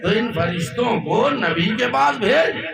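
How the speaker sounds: high, loud, slow declaiming question; fiery sermon climax; preaching at full force. Acted. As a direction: fling the question at the gathering.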